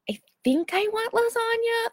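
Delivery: very high, hesitant customer; acted uncertainty. Undecided diner ordering from the waitress, high and unsure. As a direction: waver over the order in a high put-on voice.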